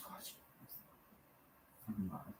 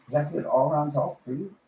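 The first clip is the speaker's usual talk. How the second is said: normal talking voice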